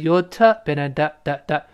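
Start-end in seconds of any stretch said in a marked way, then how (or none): none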